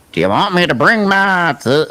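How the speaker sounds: lightly mimicked thick Southern accent; imitating a thick accent. Host mimicking Curtis asking again, a light thick accent. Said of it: "He had a really kind of a thick accent for me".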